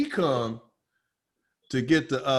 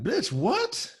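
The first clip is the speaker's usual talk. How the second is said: sharp, higher disbelieving snap; can't believe she asked; incredulous; 12 semitones higher than usual. Retold or imitated. To the buyer offering a check, incredulous.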